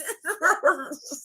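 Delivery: quiet trailing laugh; soft laugh. Real, not acted. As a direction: laugh it off softly. Laughing after the line.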